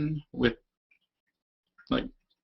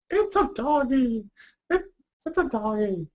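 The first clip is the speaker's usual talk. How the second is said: gushing viewer; 14 semitones higher than usual. Retold or imitated. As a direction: coo at the screen like a dog lover. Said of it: "People would be like".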